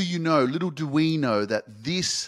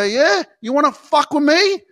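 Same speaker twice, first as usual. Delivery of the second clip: high, loud taunting dare; loud defiant taunt; defiant challenge; 10 semitones higher, 7 dB louder than usual. Retold or imitated. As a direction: throw the challenge back at them. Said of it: "you're gonna go".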